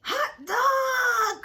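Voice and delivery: put-on high, loud shouted cheer of kids; a class cheering at the top of their lungs; joyful cry. A narrator's reading. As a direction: yell it out in delight. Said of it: "cried the class".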